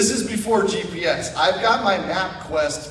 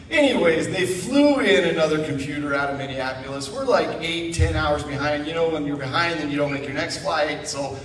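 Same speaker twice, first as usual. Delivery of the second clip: ordinary talk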